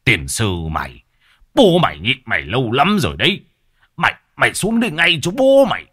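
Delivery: furious father shouting; angry cursing. A narrator's reading. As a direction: curse the son down from the tree. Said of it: "rồi chửi".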